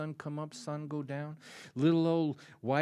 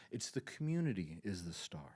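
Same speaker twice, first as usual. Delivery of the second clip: hushed, reverent whisper; 6 semitones lower, 6 dB quieter than usual. Real, not acted. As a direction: drop to a breathy whisper as if sharing a secret. Hushed awe about community music.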